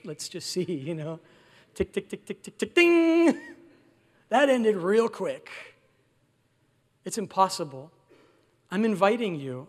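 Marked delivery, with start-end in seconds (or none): 1.7-3.4 stopwatch ticking. Retold or imitated. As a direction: tick out a timer, ring the bell. Stopwatch running then ding.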